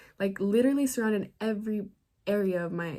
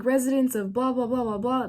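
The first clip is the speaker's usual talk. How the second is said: loud police bullhorn order, a bit higher; cops yelling over a megaphone; 4 semitones higher, 4 dB louder than usual. Retold or imitated. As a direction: bark it through a loudspeaker. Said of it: "they're yelling in their speaker".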